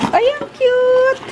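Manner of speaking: high, drawn-out delighted squeal; gushing. Real, not acted. Vlogger gushing over a cute kitchen item, high and slow. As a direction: gush like it's adorable.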